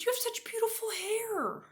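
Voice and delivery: put-on sweet little boy flattering his teacher. Little boy's compliment voiced.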